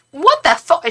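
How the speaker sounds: mock Cockney. Hostile woman objects to a tea timer, mock Cockney. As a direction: imitate an outraged Cockney Londoner. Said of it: "She had a really Cockney accent".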